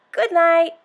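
lightly voiced zoo animal; gorilla saying good night. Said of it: "The gorilla said".

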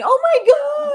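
high, loud hammed-up crying; mock sobbing winner. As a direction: wail it like an overcome winner. Mocking a sobbing award winner.

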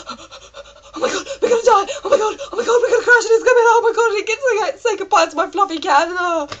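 high, gasping hysterical flyer; hammed-up wailing panic, pitched right up; mock terrified. Terrified woman on the plane behind her, mock hysterical panic. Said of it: "she's terrified of planes".

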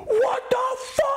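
mock fan screech in sudden disbelief; fans yelling. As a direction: squeal it like an overexcited fan. Fans spot him, yelling.

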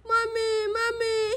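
put-on tearful little girl calling for her mummy; child crying. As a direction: sob out mummy like a small child. Child cries for dead mother.

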